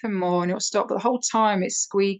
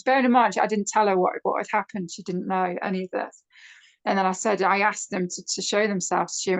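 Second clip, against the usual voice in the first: normal talking voice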